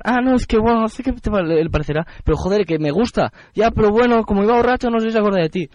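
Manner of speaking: lightly mocking retold friends' voices; imitating his friends. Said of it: "pero decían".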